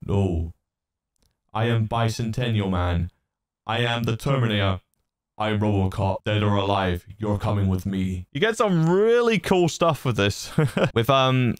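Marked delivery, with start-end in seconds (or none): 0.0-8.2 low, even robot monotone; more robotic voice. Shown as a demonstration. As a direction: play a movie robot. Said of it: "And more robotic".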